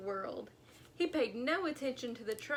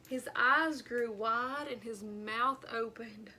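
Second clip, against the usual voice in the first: ordinary delivery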